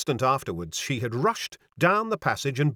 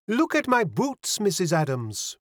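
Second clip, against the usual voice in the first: put-on high, exclaiming French girl; French young lady, pitched up; mincing French accent; 7 semitones higher than usual. A narrator's reading. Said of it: "with a touch of the mincing French accent".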